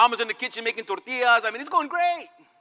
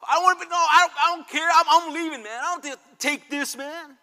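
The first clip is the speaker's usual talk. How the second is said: angry spouse; 6 semitones higher, 5 dB louder than usual. Retold or imitated. Spouse storming out in a quarrel.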